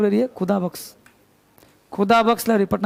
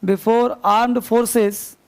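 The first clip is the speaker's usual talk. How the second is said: very slow dictation pace; teacher dictating grammar rule; much slower than usual. Shown as a demonstration. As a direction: drag each word for students to write. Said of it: "teacher dictating slowly".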